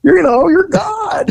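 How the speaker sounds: high shouted mock rebuke; mock outrage. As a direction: scold him in disbelief. Mock scolding of informant.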